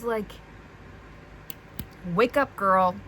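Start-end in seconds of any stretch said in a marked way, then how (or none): 2.1-3.0 loud, exasperated scolding; frustrated. Real, not acted. Reader yells at the heroine to wake up, frustrated. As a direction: shake some sense into her, loudly. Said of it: "I'm actually so frustrated at D M".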